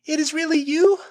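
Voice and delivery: high, loud amazed question. A narrator's reading. Flora meeting Tarzan in the jungle, high amazed question. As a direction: ask it in disbelief and relief.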